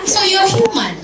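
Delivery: high laughing so you're human; laughing punchline. Real, not acted. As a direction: deliver the payoff through a laugh.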